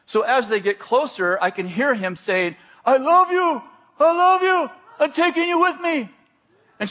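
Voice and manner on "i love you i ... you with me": high, loud declaration; retold yelling. Pursuer yells at fleeing girl as he chases her, high urgent yelling. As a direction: call it out high and forceful. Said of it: "he's yelling something".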